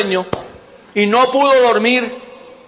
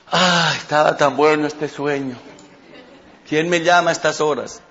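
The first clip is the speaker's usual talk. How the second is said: sleepy Daniel; 5 semitones lower, 4 dB quieter than usual. Acted. Daniel woken by the king.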